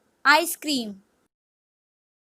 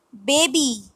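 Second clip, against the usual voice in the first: ordinary talk, nothing marked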